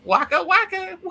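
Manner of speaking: very high, cartoonish catchphrase; comic catchphrase voice.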